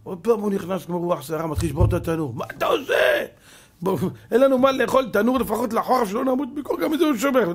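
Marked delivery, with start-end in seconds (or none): 2.3-7.5 high, loud scolding; wife's outraged shout. Acted. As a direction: scold him for wrecking the stove. Wife shouts at husband. (אישה צועקת על בעלה בכעס)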